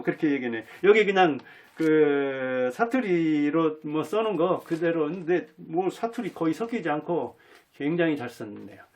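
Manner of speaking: normal talking voice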